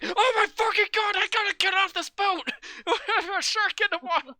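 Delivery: hammed-up panicked screaming, very high; mock panic. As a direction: shriek like a panicking movie crowd. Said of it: "everyone is just like".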